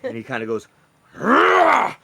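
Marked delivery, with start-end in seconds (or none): 1.1-1.9 high, loud acted lion growl-roar; frustrated lion's grumbling roar. Lion fighter roars as he looks at his rival. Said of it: "a lion kind of grumble and roar".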